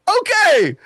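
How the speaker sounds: high, loud retold disbelief; imitated startled outburst; alarmed reaction. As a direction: burst out high and loud, acting stunned. Acted alarmed reaction to demon.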